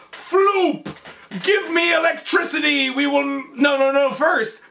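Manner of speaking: high-pitched mock commands to an assistant; excited mad scientist. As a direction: cry out like a frantic scientist. Acting the scientist calling his assistant.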